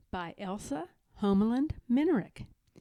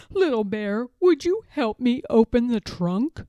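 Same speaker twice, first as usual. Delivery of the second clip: put-on louder old grandfather voice; grandfather bear; 7 dB louder than usual. A narrator's reading. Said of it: "Grandfather said".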